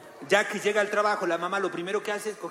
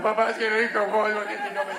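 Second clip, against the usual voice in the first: niño suplicando. Pretend. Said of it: "Presión emocional sería".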